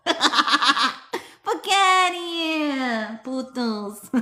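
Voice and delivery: very slow, high, laughing New Jersey send-off; New Jersey accent. Acted. Ending the mantra card for friends, in a drawn-out New Jersey accent. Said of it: "Say it in a New Jersey accent to add emphasis".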